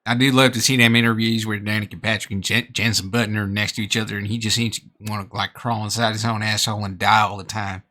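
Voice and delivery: put-on Texan American accent; mock American accent for comedy; dumb American accent. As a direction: play a dumb Texan for satire. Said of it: "portraying a dumb American".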